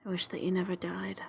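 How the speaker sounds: hushed, grieving whisper; whisper under breath. Acted. Mikhail alone with dead Leto, whispering under his breath. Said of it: "whispers under his breath".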